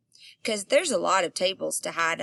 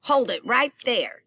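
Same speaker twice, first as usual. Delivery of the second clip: hollering man; 4 semitones higher, 4 dB louder than usual. Acted. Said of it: "he hollered again".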